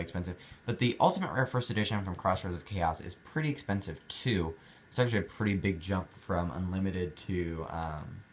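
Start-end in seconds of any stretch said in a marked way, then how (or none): none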